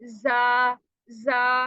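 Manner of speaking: जा with the right buzzing sound; correct zha. Shown as a demonstration. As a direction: buzz जा from the proper place. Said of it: "क्या हमने निकालनी है". (सही भनभनाती आवाज़ में जा)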